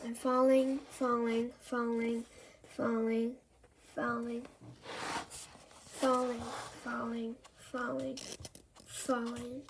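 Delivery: fading from soft to almost silent; drifting off. A narrator's reading. Andy falling asleep in bed, voice fading away.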